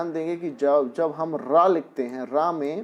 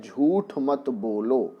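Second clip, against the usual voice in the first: "झूठ मत बोलो" slowly, with a clear aspirated ठ at the end of "झूठ"; slow careful pronunciation; much slower than usual. Shown as a demonstration. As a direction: spell out "झूठ" slowly, stressing the ठ. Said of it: "वहाँ पर ठा का ही उच्चारण होना होता है".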